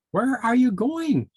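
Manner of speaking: high, slow, curious pika voice; a little pika calls out to Tammy. A narrator's reading. Peter Pika asks Tammy.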